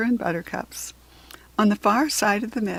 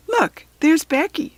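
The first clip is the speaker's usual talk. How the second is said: higher, louder spotting someone; excited girl calling out; 8 semitones higher, 6 dB louder than usual. Acted. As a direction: point her out happily. Kirsty spots Becky.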